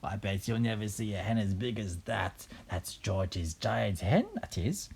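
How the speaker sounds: put-on old granny voice; shouting Grandma. A narrator's reading. Grandma boasting to Mary, put-on voice, mock shout. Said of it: "Grandma shouted".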